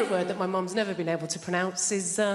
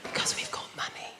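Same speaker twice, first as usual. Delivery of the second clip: hushed, secret whisper; 7 dB quieter than usual. Shown as a demonstration. Middle class speaker whispering about money.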